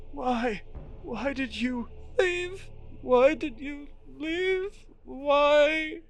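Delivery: high, slow, wailing questions; slow high sobbing plea; sobbing man. Acted. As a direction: cry out to someone who left. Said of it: "the sobbing man's next words".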